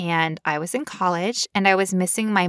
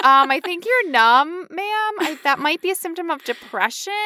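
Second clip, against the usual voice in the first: doctor voice; 9 semitones higher than usual. Retold or imitated. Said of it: "And you're like".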